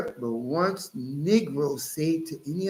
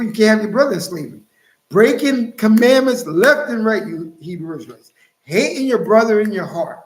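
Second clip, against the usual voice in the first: louder, rising to a preaching shout; fiery preaching; 3 semitones higher, 8 dB louder than usual. Real, not acted. Preacher rebuking the Hebrew Israelites, loud and fiery. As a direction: call them out like a street preacher.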